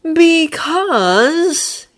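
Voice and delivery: high, soothing placating tone; smooth it over gently. Acted. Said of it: "Draco said placatingly".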